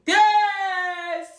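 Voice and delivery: high, loud victory shout; triumphant yes. Real, not acted. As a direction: burst out in triumph. Triumph when he won't drop her.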